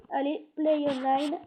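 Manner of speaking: slow, hammed-up English accent on the words; jokey English accent for French viewers; mock English accent. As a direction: lay on a silly English accent, slowly. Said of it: "Avec mon superbe accent anglais".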